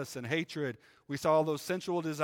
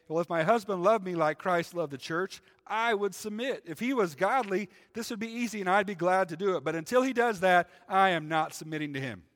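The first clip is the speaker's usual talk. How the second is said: higher, louder, emphatic imitation of a wife's protest; mildly hammed-up conditional refusal, pitched up; mock defiant wife; 7 semitones higher, 8 dB louder than usual. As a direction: act out a wife's indignant refusal. Acted complaint of a reluctant wife.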